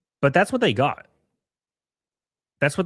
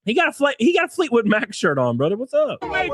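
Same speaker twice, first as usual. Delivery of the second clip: surprised shout; 9 semitones higher than usual. Real, not acted. Surprised at Fleetwood Mac shirt.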